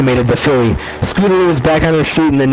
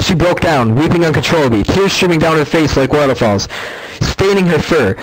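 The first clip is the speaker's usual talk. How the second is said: plain reading voice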